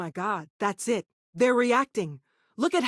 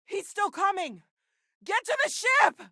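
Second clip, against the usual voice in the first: much higher, frantic cry to flee; panicked shout; 10 semitones higher than usual. Acted. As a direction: shriek it as he closes in. Fleeing a pursuer.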